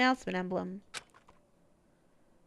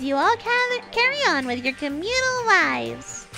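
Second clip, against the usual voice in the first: high, loud, hyped announcer voice; fighting game announcer; 9 semitones higher, 6 dB louder than usual. Acted. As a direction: boom it out like a show host. Announcer voice in story.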